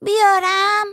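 put-on high, loud little-kid voice; child calling out. A narrator's reading. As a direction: call from the other room like a small child. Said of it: "آتی از اتاق داد زد". (صدای بلند و نازک بچه از اتاق)

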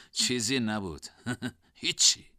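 awkward little laugh between the words; strained, smiling chuckle; forced chuckle. Acted. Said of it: "به زور لبخند زد".

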